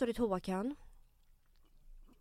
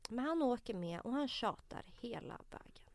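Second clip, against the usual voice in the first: whisper; 6 dB quieter than usual. Real, not acted. Hushed aside in story.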